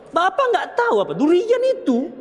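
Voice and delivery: a child talking back, mildly acted; scolding a parent. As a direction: snap back at Dad about the durian. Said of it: "Nggak usah begitu".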